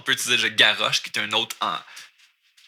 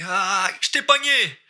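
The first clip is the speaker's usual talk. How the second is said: playful "gotcha" shout, pitched way up; playing tag; 9 semitones higher than usual. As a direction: call out as if tagging someone. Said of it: "tu joues à tag".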